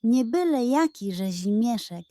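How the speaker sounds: plain reading voice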